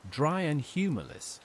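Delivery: usual speaking voice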